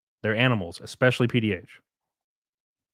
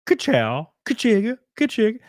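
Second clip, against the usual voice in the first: punchy catchphrase, jumping much higher on the repeats; best ka-chow catchphrase; 9 semitones higher than usual. Shown as a demonstration. Streamer obliging a viewer's request, playful catchphrase voice. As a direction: throw out a playful, high-pitched catchphrase. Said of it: "Can I get your best".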